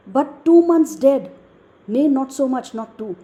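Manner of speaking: loud, high outburst dropping away at the end; emphatic dramatic recitation; Hamlet. Acted. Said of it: "being extremely emotional".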